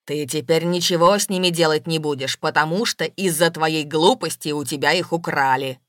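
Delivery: low, loud tirade; angry bellowing. A narrator's reading. Goblin blames his beloved for the theft, bellowing. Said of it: "продолжал орать гоблин".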